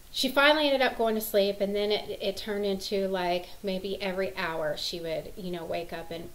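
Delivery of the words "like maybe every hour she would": normal talking voice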